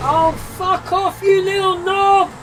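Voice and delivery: loud, high furious yell; angry shout. Real, not acted. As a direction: blast them at the top of your voice. Swearing at other racers.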